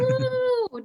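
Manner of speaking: sudden high whoop; cheering. Real, not acted. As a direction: let out a high happy whoop. Host cheers Josh's intro.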